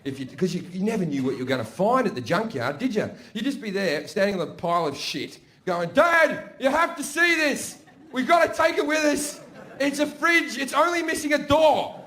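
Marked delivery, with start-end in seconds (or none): 5.9-11.9 high, eager calling out; excited kid. Retold or imitated. Kid on a junk pile shows Dad a fridge, excited. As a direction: imitate a thrilled little boy calling Dad over.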